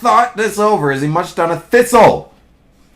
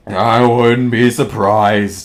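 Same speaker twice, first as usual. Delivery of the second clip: slow, very low gloomy donkey; low, slow storybook donkey; Eeyore; 10 semitones lower, slower than usual. A narrator's reading. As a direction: drag it out deep and glum. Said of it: "said Eeyore".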